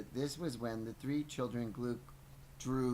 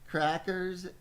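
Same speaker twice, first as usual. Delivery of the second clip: gleeful emphasis; 7 semitones higher, 6 dB louder than usual. Real, not acted. Relishing the catchphrase.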